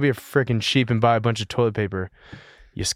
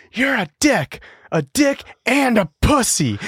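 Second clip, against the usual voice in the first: mock yell; 8 semitones higher, slower than usual. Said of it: "she just yells from the kitchen".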